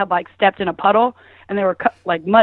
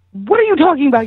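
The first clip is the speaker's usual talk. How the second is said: higher-pitched, disbelieving retort; incredulous; 6 semitones higher, 4 dB louder, faster than usual. Retold or imitated. Woman arguing with her hookup about the door, incredulous. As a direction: re-enact balking at a bad idea.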